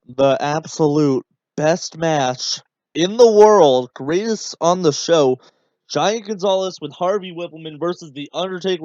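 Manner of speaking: oversell it like a fake announcer; hammed-up sarcasm. Shown as a demonstration. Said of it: "Let me try it again".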